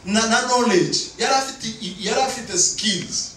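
high, insistent sermon voice; emphatic preaching. Real, not acted. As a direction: drive each point home from the pulpit. Preaches at full force.

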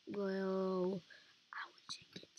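hushed whisper, barely voiced; soft whispered aside. Real, not acted. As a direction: breathe it out as a secret. Quiet aside while playing.

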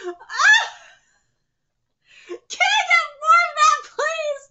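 high, loud fangirl cry; ecstatic plea. Real, not acted. Fan overcome, begging for more.

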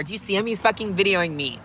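normal talking voice

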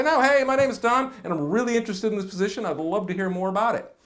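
high, bright energetic greeting with real life; upbeat and lively. Shown as a demonstration. Coach showing the good phone introduction, lively. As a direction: sound glad to be talking. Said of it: "Just say".

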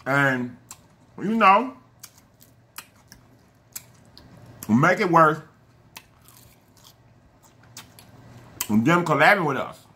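chewing while talking; speaking between bites of salad; mouth full. Real, not acted.

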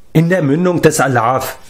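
reply with a touch of annoyance at being interrupted; slightly annoyed. A narrator's reading. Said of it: "etwas verärgert". (etwas verärgert)

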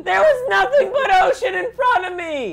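high, loud, laughing shout; exasperated shout. Real, not acted. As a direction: yell in disbelief, half laughing. Crashing into land again.

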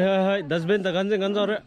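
ordinary talk

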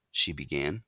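ordinary delivery

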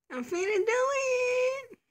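high, slow, strained cry; straining cry. Acted. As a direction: push the voice up with the effort. Straining to open drawer.

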